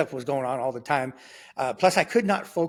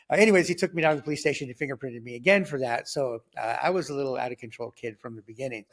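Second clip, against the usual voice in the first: ordinary delivery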